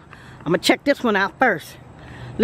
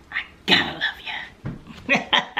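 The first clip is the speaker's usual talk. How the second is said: whispered laugh; 2 semitones higher, 6 dB quieter, much slower than usual. Real, not acted. Zärtlich lachend zum Publikum.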